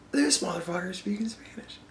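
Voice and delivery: whispered aside in disbelief; mock stunned whisper. Cory Booker looking at his rival on stage, whispering in disbelief. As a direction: whisper it like a private, indignant thought. Voicing Booker's reaction to Beto.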